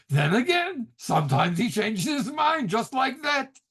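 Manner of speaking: put-on high-pitched, comically conceding Yiddish accent; Gene Wilder's Yiddish accent. Rabbi backtracking as the rain falls, comic Yiddish accent. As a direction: deliver a punchline as a Yiddish-accented movie rabbi. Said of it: "I love his accent".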